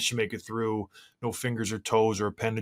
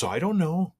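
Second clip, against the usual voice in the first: put-on kid's shrug voice; 7 semitones higher, 3 dB louder than usual. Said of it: "And she goes".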